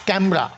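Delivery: ক্যামেরা as two syllables, "KAM-ra"; syncope, vowel dropped. Shown as a demonstration. Teacher shows students syncope, ক্যামেরা shortened to "kemra". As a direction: drop the middle e in ক্যামেরা: "kemra". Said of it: "ইর উচ্চারণটা বাদ দেওয়া হয়".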